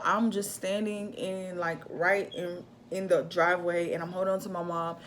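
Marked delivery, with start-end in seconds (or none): none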